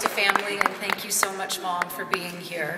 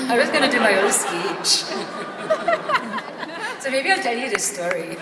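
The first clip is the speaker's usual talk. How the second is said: talking through real laughter, higher and louder; laughing; 5 semitones higher, 5 dB louder than usual. Real, not acted. Speaker laughing at her own accent joke to the crowd, giggling through words. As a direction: crack up mid-sentence.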